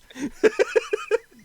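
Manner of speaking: a sudden high evil cackle. Real, not acted. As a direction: let out a gleeful villain cackle. GM laughs at denied story plea.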